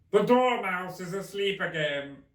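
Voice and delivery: drawn out and loud like the Hatter. Acted. Hatter remarking on Alice's hair, slow and loud.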